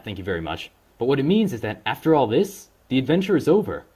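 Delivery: ordinary delivery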